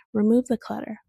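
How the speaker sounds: ordinary delivery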